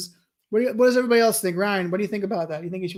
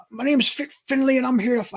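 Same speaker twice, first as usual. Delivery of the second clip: a higher, hammed-up Irish accent as a tough wrestler; bad Irish accent; 6 semitones higher, faster than usual. Streamer voicing wrestler Fit Finlay's intro in a bad Irish accent. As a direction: put on a clumsy Irish accent for a fighter's intro. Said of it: "I can't do an Irish accent".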